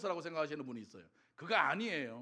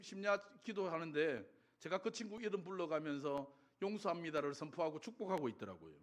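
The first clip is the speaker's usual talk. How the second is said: normal talking voice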